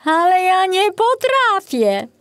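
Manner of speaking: put-on young forester groaning at his new job; whining groan.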